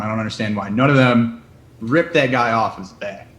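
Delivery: ordinary talk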